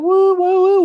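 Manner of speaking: high, loud party whoops; whooping. Real, not acted. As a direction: cheer like the crowd just scored. Streamer whooping at chat.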